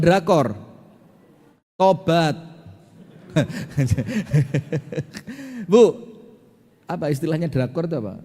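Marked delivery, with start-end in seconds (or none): 3.3-5.6 real chuckle. Real, not acted. Preacher teasing women who watch Korean dramas, amused chuckle. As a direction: let out a real, teasing laugh.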